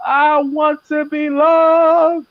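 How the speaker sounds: high, very slow and drawn-out "I want to be loved"; timid woman. Pretend. Woman scared to say what she wants, high and drawn out. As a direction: play a shy woman, stretching "loved" very slowly. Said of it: "Shout it from the rooftop".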